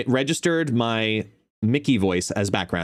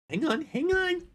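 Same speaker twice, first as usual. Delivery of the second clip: high, softer falsetto; high pet talk; 5 dB quieter than usual. Real, not acted. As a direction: squeak it softly to a pet. Playing with the dog.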